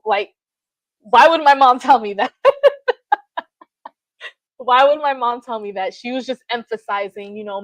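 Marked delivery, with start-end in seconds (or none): 2.4-3.1 short, high laugh bursting out; high burst of laughter. Real, not acted. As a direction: laugh out loud at the absurd text. Laughs at mom's comment.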